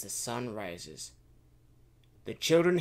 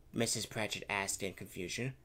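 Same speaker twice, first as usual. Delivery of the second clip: ordinary delivery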